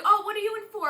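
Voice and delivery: high, loud, chummy question; curious kid voice. Retold or imitated. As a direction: ask like a fellow inmate. Kid in nurse's office asks.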